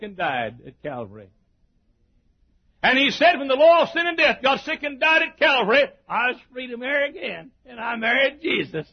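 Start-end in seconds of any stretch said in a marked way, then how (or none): 2.8-8.9 high-pitched, driving pulpit delivery; exultant sermon cry, easing a little at the end; full-force preaching. Real, not acted. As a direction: preach it with rising fervour.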